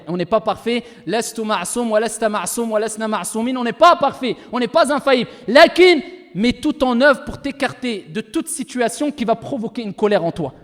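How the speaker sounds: ordinary talk, nothing marked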